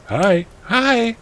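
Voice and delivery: high-pitched little greeting; mock-meek high reply; lightly voiced boyfriend. Boyfriend answering the heroine, high mock greeting.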